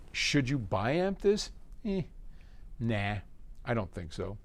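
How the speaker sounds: ordinary talk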